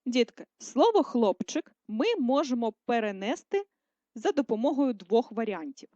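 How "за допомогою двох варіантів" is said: usual speaking voice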